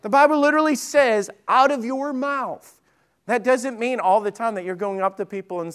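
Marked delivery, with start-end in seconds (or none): 1.5-2.8 slow, high-pitched "out of your mouth"; emphatic quote. Acted. Preacher quoting the Bible to the congregation, emphatic. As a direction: spell out OUT OF YOUR MOUTH word by word.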